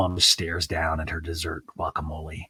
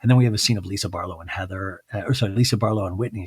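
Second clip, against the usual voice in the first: usual speaking voice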